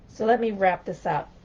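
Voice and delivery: ordinary delivery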